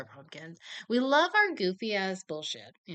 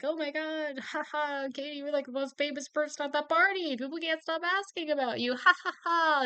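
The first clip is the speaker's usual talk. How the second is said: lightly voiced teasing friends, a bit higher; mocking party friends; 7 semitones higher than usual. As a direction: tease Katie with fake giggly gushing. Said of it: "people keep joking".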